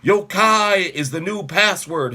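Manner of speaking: loud, slow official announcement; commanding call. Acted. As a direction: bluff with authority. Bluffing soldier calls down.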